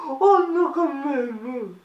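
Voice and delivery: high laughing protest breaking into a cry. Acted. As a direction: laugh it out, voice cracking. Laughs at her own story. (protestation aiguë en riant)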